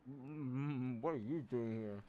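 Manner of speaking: ordinary talk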